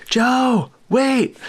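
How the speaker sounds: high mock yell of a name; retold yell. Sound guy chasing Joe to the helicopter, high mock yell. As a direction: call out high, imitating the panic. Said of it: "yelling, screaming his name".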